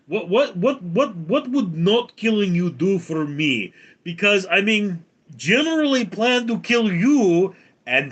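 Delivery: put-on high, loud rambling Russian accent; casually menacing dictator, Russian accent; Putin. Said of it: "And Putin goes".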